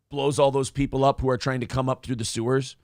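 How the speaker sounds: usual speaking voice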